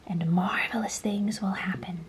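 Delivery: hushed, breathy secret; whisper. A narrator's reading. Mysterious old man whispering to James. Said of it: "the old man whispered".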